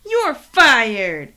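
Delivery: loud shouted firing; mock firing shout. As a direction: bellow it like a fed-up boss.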